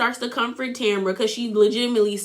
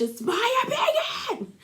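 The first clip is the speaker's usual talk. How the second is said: pitched way up in a put-on voice; a hammed-up high, softer impression; mock reality star; 4 dB quieter than usual. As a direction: parody a huffy reunion quote. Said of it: "It gave me very much".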